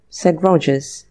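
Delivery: ordinary delivery, nothing marked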